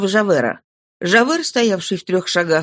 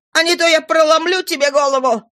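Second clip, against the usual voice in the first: high, sustained threat; shouting; 8 semitones higher than usual. A narrator's reading. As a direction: hold the pitch high in an acted menacing cry. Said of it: "крикнула она".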